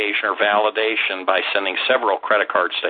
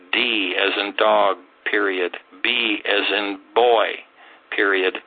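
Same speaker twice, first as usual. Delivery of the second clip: dictating. Shown as a demonstration. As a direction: dictate each letter clearly for note-taking. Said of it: "I'm gonna give you a couple, uh, citations here".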